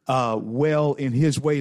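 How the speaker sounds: normal talking voice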